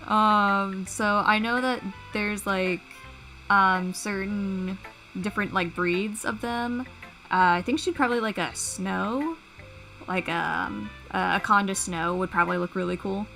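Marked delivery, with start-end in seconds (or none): none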